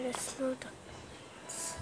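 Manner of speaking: slow dragged-out whisper. Real, not acted. Gamer dismayed at an empty map, slow whisper. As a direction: let it trail off slowly under your breath.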